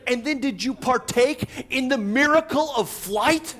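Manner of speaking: high, loud mock disbelief; incredulous comedian. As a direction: ham up the comic's outrage. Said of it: "He's like".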